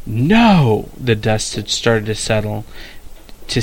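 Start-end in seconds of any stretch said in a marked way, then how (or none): 0.1-0.9 sharp loud no; shout. Acted. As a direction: cry it out. Old man shouts in battle.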